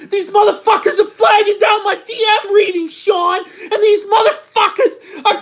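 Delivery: high, strained frantic yelling; screaming impression. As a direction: rant at Sean in a shrill mock meltdown. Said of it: "I gotta stop doing that impression or my fucking voice is gonna give out".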